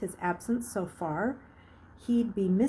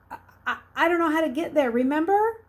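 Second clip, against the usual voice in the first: fast, higher, louder crafty innocence; playing dumb; 8 semitones higher, 7 dB louder, faster than usual. A narrator's reading. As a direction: feign helpless confusion. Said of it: "Now he had to be crafty".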